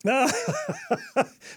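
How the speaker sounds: high surprised laugh; burst of laughter. Real, not acted. Host laughs at the cheer.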